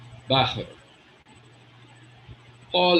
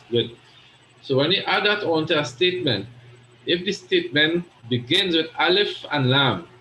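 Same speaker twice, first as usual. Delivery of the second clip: normal talking voice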